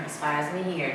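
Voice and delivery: soft conspiratorial murmur; hushed aside. Shown as a demonstration. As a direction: drop to a hush like gossip you shouldn't repeat. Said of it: "don't quote me".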